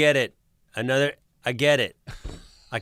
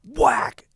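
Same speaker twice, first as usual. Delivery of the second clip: high, loud impact noise; sudden whack; 12 semitones higher, 5 dB louder than usual. Retold or imitated. Driver acting out deer hitting suddenly, sharp loud whack. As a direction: burst it out in one sharp hit. Imitating the deer's sudden leap.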